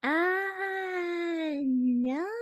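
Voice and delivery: high, drawn-out mock "ah, no"; exaggerated high cry, then no; mimicked person. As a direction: imitate someone wailing a long refusal. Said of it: "one whole person that actually wants to say".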